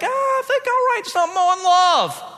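airy mock musing. Imagined writer idly deciding on a topic, high mock musing. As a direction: play a whimsical writer, pitched well up.

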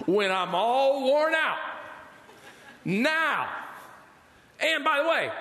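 high, mocking old woman's cackle and words; laughing old Sarah. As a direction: play Sarah laughing in disbelief at her age. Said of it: "And she starts laughing".

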